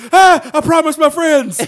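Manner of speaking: high, loud pleading wail; whiny plea. Retold or imitated. Man begs the soda can, high loud whine. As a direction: beg like a disappointed man. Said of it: "And he goes".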